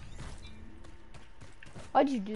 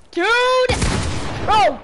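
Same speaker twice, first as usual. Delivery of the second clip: high, loud exasperated yell; frustrated shout; 8 semitones higher, 5 dB louder than usual. Real, not acted. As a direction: shout out at the screen. Killed in game, outburst.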